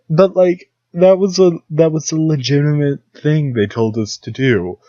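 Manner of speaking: high, loud, still half laughing; disbelieving outburst. Real, not acted. Comic recalling the absurd school lesson, loud and incredulous. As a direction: blurt it out in disbelief.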